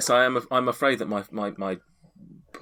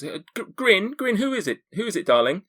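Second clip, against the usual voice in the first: much higher, louder woman calling from inside; a mother calling from inside the cottage to her son, high and loud; female voice calling; 13 semitones higher, 5 dB louder than usual. Acted. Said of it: "a sort of a female voice goes".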